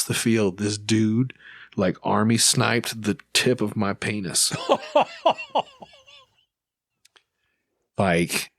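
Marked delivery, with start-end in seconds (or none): 4.6-5.8 a real burst of high-pitched laughter; high squealing laugh. Real, not acted. Laughs at own painful story.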